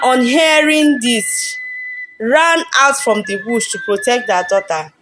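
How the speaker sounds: ordinary delivery, nothing marked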